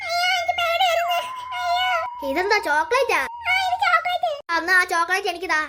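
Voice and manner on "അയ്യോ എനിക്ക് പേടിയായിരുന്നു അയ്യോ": put-on frightened small girl, squeaky and high; childlike wail of fright; high little girl voice. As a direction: play a scared little girl crying for her brother. Child character crying out.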